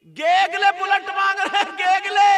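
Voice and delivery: high, loud, chanting jeer; full-volume sermon scorn; mocking preacher's shout. Real, not acted. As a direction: cry it out to ridicule them. Mocking grooms, repeated plainly later.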